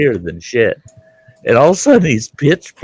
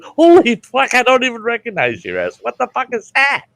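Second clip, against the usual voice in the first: shocked disbelief; 13 semitones higher than usual. Retold or imitated. Seeing friend's huge new teeth, a high shocked yelp. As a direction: act floored by the giant new teeth. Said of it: "And I'm going".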